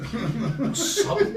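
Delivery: high, drawn-out laughing voice; laughing recollection. Real, not acted. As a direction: say it through real laughter. Laughs while recalling his tears.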